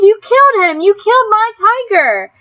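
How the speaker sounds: high, loud raging accusation; furious scream. A narrator's reading. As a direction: hurl it at him. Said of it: "Rob screamed".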